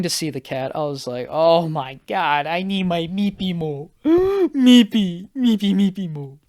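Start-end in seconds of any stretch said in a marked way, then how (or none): 1.3-6.4 put-on whiny baby talk for a beloved cat; mock cat-longing. Guest dying to see his sister's cat, pining baby talk. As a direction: coo the pet name, rising high after a gasp.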